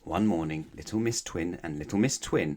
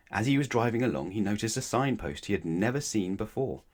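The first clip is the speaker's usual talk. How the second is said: usual speaking voice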